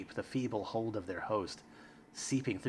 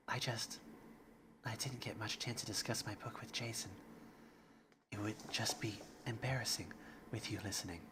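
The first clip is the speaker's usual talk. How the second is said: much softer shy whisper; 12 dB quieter than usual. Acted. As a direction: whisper it, embarrassed. Shy Yuri.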